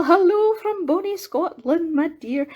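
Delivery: high, playful put-on Scottish accent; fakey Scottish accent. Host greets Nicole from Scotland, fake Scottish accent. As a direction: greet them in a hammed-up Scottish lilt. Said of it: "a fakey Scottish accent".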